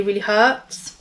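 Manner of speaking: normal talking voice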